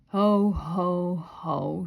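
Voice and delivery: a hollow laugh that falls; half-hearted mournful ho ho ho. A narrator's reading. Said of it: "she said mournfully".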